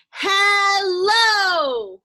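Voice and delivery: full, high shouted hello; teacher shouts hello as a loud-voice demo. Shown as a demonstration. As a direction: shout it out big for the class. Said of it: "Can you say hello very loud?".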